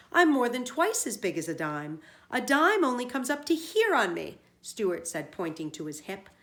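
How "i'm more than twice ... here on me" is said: angry. A narrator's reading. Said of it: "replied Stewart angrily".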